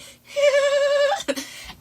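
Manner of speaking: high squealing burst of laughter; real high-pitched laugh; shrieking laugh. Real, not acted. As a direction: laugh out loud, high and long. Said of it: "breaks up laughing".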